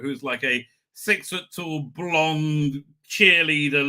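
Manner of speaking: ordinary talk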